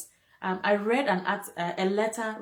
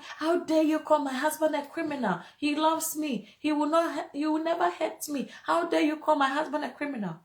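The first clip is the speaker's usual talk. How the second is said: retold furious; 6 semitones higher than usual. Imitating Abigail defending her husband to a friend, mildly furious. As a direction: imitate an outraged wife defending her man. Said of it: "she got so furious".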